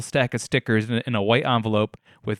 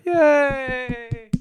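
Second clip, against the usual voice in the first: mock cheer; 12 semitones higher than usual. Mock cheer alone at home.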